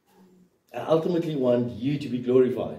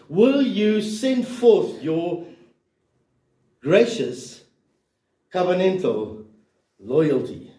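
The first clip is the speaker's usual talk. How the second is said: drawn-out prayer; 4 semitones higher, 3 dB louder, much slower than usual. Acted. Preacher voicing the psalmist's prayer.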